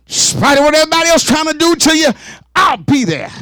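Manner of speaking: high and fast shouted line; rapid, fervent shout; preaching shout. Real, not acted. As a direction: drive it home at full preaching force. Preaching climax.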